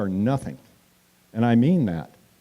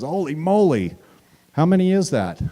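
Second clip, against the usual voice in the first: higher, louder startled gasp of a reporter; mock astonished reporter; 5 semitones higher, 4 dB louder than usual. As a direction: play the reporter caught off guard. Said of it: "A Sacramento Bee guy says".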